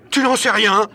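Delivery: high, loud incredulous cry; howling. A narrator's reading. Michel howls at Barbicane inside the projectile, shouting. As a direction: yell it back in disbelief. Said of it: "s'écrie Michel avec un hurlement".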